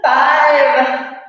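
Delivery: loud, pushed through exhaustion; straining effort. Real, not acted. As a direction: force the count out under strain. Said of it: "my arms are not working anymore".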